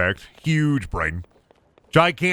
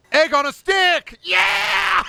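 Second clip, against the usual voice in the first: high, loud, drawn-out victory yell; triumphant shout; 4 dB louder, much slower than usual. Real, not acted. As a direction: cheer it like you just won. Triumph over crafted weapon.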